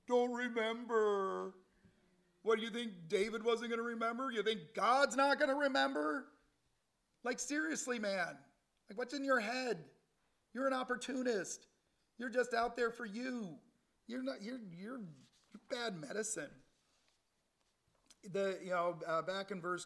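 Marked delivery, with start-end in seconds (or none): 0.1-1.5 slow, loud, higher mock Shimei voice; drawn-out mocking dismissal, lightly voiced; Shimei's brush-off. Preacher mocking Shimei answering David, loud and slow. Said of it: "What did he say to David?". 2.4-16.5 high, loud preaching at full force; fervent rebuke. Acted. Preacher scolding Shimei before the congregation, high and loud.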